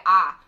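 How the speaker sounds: "ah" loud and open, no glide; Texan "ah". Shown as a demonstration. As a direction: mimic the Texan flat "ah". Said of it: "you say".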